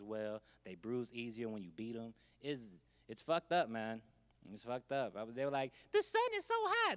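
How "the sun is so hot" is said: very high, mock complaining voice; high nasal-free mocking whine; whiny falsetto complaint. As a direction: whine it like a pampered volunteer.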